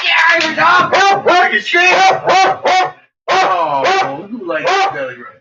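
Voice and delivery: high, loud, drawn-out excited call to the dog; loud high-pitched delight at the dog; excited squeal. Real, not acted. Excited at dog's belly rub.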